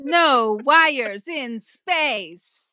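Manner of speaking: very slow, loud, drawn-out No wires in space; dramatic proclamation. Acted. Riffing on Stamets, a slow booming pronouncement. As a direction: boom it out like a movie tagline.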